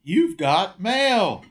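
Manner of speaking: high, slow, drawn-out announcement; mock email greeting. Guest mentions working at AOL, host playfully mimics its greeting. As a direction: sing out each word slowly and high.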